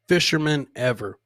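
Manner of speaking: ordinary talk, nothing marked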